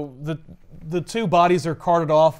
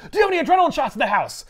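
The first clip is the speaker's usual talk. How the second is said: fast, high urgent ask; frantic question; 9 semitones higher, much faster than usual. Retold or imitated. Felicia asking the girl for adrenaline, frantic and fast. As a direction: rattle it off in a panic. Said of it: "she goes".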